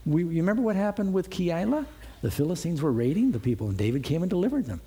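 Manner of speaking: ordinary delivery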